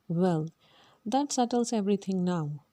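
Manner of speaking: ordinary delivery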